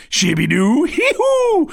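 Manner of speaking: silly nonsense sounds leaping very high; goofy babble shooting up into a squeal; Adam Sandler. Acted. Comedian aping a silly comic actor, high goofy impression. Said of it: "really silly".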